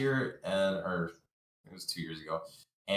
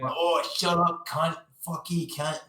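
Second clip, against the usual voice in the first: slow, high mock Australian accent; 8 semitones higher, slower than usual. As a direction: sneer it in an over-the-top Australian accent. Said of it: "Australian and not at all British".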